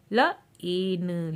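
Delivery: ल, ए, न said sound by sound. Shown as a demonstration. Teacher showing magic E words, sounding out ल, ए, न. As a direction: separate ल, long ए, न. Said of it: "ए का हम लॉन्ग साउंड".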